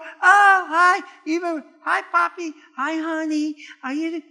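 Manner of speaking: sweet family greeting. Retold or imitated. Family greeting dad on Father's Day morning, high sugary coo. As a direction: coo over dad like it's his special day. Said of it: "they treated me and they go".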